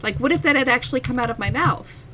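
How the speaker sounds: ordinary talk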